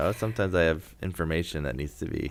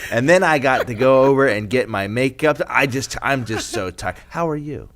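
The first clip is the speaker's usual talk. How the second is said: loud, fast-talking frazzled Betsy answering the phone; impression of Betsy; 5 semitones higher, 9 dB louder, slower than usual. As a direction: rattle off your problems loudly without saying hello. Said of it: "I wanna do my impression of you".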